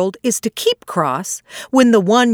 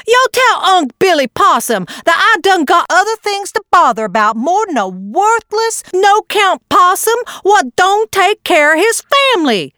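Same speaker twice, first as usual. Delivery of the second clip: high, cross scolding voice; a mother possum scolding in a higher voice; crossly; 11 semitones higher than usual. Acted. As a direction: snap it like an annoyed wife. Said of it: "she said crossly".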